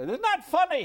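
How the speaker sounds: very high, loud, delighted question; Chaplin amused. Retold or imitated. Chaplin showing his son's birthday film, very high and delighted. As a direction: squeal it like Chaplin finding it hilarious.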